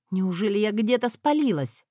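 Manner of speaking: plain reading voice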